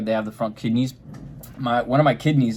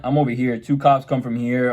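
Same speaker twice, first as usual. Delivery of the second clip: ordinary delivery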